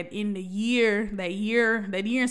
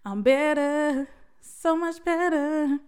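slow, higher, drawn-out declaration of being better; proud affirmation; 6 semitones higher, much slower than usual. Real, not acted. Proud vlogger telling viewers she healed, slow and lifted. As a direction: savor each word of it.

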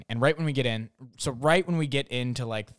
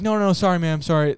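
hasty apology; 4 semitones higher, 6 dB louder, faster than usual. Retold or imitated. Managers apologize to mom.

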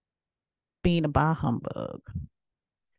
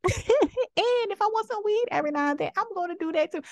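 laughs through it, high and giddy; laughing; 12 semitones higher than usual. Real, not acted. Laughing at herself while admitting she smokes weed. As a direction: giggle out the confession.

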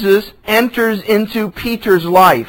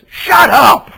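a sharp, loud, higher "Shut up!"; angry shout; 6 semitones higher, 6 dB louder than usual. Retold or imitated. Pastor voicing Jesus yelling at a demon, angry shout. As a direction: bark it at the demon. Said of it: "I interpreted that as".

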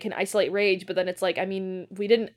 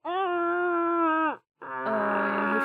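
shaken after a fright; 6 semitones higher than usual. Real, not acted. Recovering after the scare.

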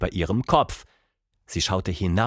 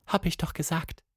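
soft, high, sorrowful monkey voice; sad; 7 semitones higher, 5 dB quieter than usual. A narrator's reading. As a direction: say it quietly, resigned and sad. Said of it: "sagte die Äffin traurig".

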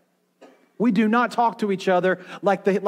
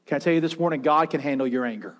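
ordinary talk

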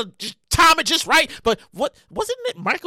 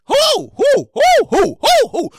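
high, loud repeated demand; outraged hooting; 8 semitones higher, 6 dB louder, much slower than usual. Acted. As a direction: fire off "who" in incredulous bursts. Mock outrage at ice cream flavor.

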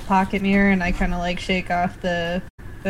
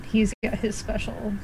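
whisper; 11 dB quieter than usual. Real, not acted. Aside whispered to table.

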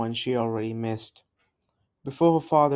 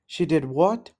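alarmed; 4 semitones higher, 3 dB louder than usual. A narrator's reading. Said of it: "called out with alarm".

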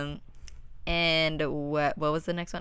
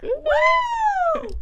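cheer; 5 dB louder than usual. Real, not acted. Cheering again after apologising.

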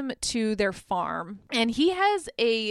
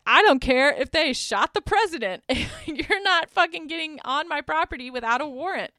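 put-on raised, defiant voice of an old farmer; a louder, higher stand-off yell; defiant old man; 5 semitones higher, 4 dB louder than usual. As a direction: tell the agents off, loud and firm. Old guy defends his property.

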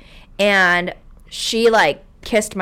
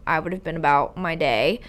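usual speaking voice

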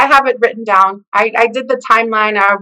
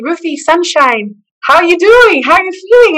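cheerful greeting; 6 semitones higher, 4 dB louder than usual. Retold or imitated. Doctor cheerfully greeting recovered patient.

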